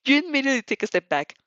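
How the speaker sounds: ordinary delivery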